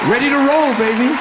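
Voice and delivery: high, loud ready-to-go boast; cocky excited cheer; hyped. Acted. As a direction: pump yourself up. Dad hyped to go.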